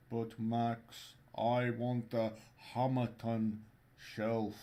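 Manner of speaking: put-on slow Scandinavian accent; drawn-out Nordic lilt. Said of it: "that's why I'm doing the Scandinavian accent".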